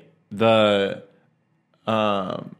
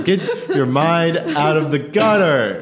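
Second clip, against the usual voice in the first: higher, slow, drawn-out telling-off; mock scolding; 5 semitones higher, 4 dB louder than usual. Host chiding friends over a dirty joke, mock scolding. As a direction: scold them playfully, stretching the words.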